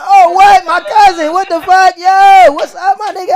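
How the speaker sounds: very high, loud excited squeal; hyped surprise. Retold or imitated. Greeting his cousin on campus in shock. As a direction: greet him like it's the best surprise ever.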